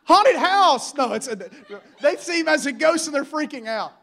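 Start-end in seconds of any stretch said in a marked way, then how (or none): 0.0-0.9 high yelp of mock terror; comic fake fright scream; mock scared scream. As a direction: ham up the disciples' panicked shriek. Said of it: "they start screaming".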